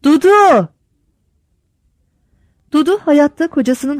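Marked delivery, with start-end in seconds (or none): 0.0-0.7 loud, high call of a name; husband calls his wife from the yard, loud; calling upstairs. A narrator's reading. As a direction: shout her name up the stairs. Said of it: "Yukarıya bağırdı".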